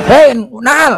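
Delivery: angry angkot driver. Acted. Angkot driver confronts gossip seller.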